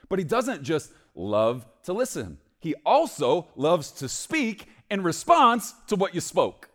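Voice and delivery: high, loud and slow, swelling on response; fervent preaching. Acted. Preacher booming to the church about God speaking. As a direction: preach it big to the congregation.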